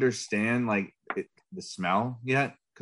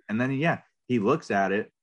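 ordinary delivery

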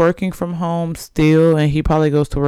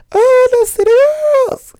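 high, loud squeal on the oh; 6 dB louder than usual. Retold or imitated. Laughing, she mimics the rapper's excited shriek of the name. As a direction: squeal the name like the viral clip. Mimicking the famous shouted line.

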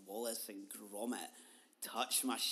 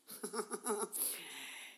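high-pitched burst of laughter; high giggle; 9 semitones higher than usual. Real, not acted. Laughing at own reply to a dream visitor, high giggle. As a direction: break into a high cackle.

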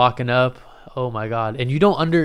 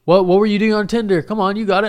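higher, louder egging-on voice; raised pitch, coaxing the comic on; heckling girl; 9 semitones higher, 5 dB louder, faster than usual. Retold or imitated. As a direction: imitate a girl calling out from the crowd. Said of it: "one girl was like".

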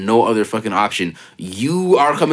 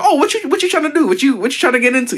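high, taunting mock voice; TikTok character voice; 14 semitones higher than usual. Imitating the TikTok guy taunting someone in a high voice. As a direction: needle them like the red shirt TikTok guy.

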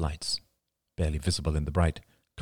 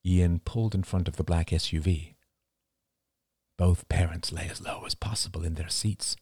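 usual speaking voice

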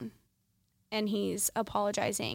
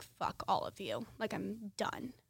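straight-faced dismissal; 8 dB quieter than usual. Retold or imitated. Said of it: "I'm just straight faced".

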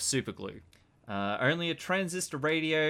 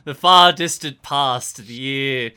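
mock grandiose; 12 dB louder than usual. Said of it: "mock grand pronouncement of the past".

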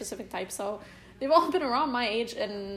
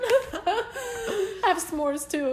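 laughing; 10 semitones higher, much slower than usual. Real, not acted. Mock tea party, cracking up.

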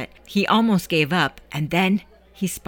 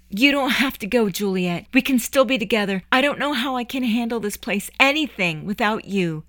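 begged; 6 semitones higher than usual. Acted. Eddie begging Juliet to stay, pleading. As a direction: beg her not to leave. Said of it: "he begged".

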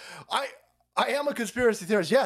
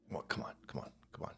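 breathy sarcastic whisper; hushed come-on; mock whisper; 18 dB quieter, much faster than usual. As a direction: whisper it like a knowing aside. Sarcastic aside.

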